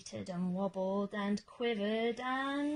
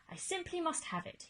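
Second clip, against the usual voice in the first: wistful cry; 8 semitones higher, faster than usual. Acted. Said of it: "he wistfully cried".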